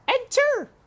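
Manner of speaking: very high, loud call. A narrator's reading. Princess calling again to the maid, high and loud.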